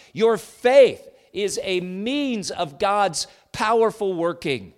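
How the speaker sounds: loud, high, slow preaching climax; preaching at full force. Real, not acted. Pastor exalting God's power to the congregation, full force. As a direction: lift the room with each phrase.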